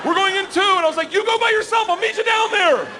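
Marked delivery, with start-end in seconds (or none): 0.0-0.6 high instructor's call, imitated; shouted countdown. Instructor counts down to nervous jumper, high urgent call. 1.1-2.9 very high acted panic; terrified pleading yell. Comic refuses to jump with instructor, shrieky high panic.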